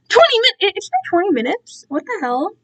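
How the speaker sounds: high, sudden "twenty minutes?"; startled surprise. Real, not acted. Noticing the stream time, she blurts out a surprised question. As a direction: catch yourself shocked at the clock.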